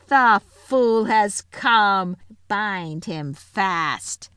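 slow, cruel witch voice, loud then dropping; gloating witch. A narrator's reading. Witch gloating as the lion arrives. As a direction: savour each word like a victory. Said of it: "gave a wild, fierce laugh".

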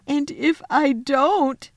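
high, slow, tearful faun; starting to cry. Acted. Faun dreading the witch's anger, tearful. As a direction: begin to crumble into tears. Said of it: "beginning to cry again".

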